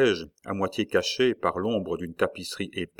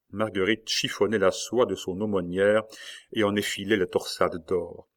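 usual speaking voice

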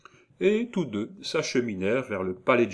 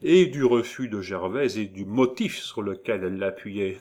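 ordinary delivery, nothing marked

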